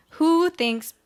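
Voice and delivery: ordinary talk, nothing marked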